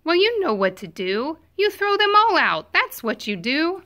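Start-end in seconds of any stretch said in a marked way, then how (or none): none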